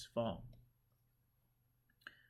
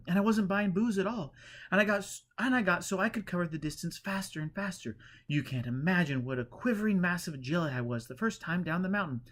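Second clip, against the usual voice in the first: higher, louder eager rush of words; excited pilgrim; 7 semitones higher, 5 dB louder than usual. A narrator's reading. Pilgrim boasting to the holy man, higher and eager. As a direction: bubble over with pride. Pilgrim tells his tale.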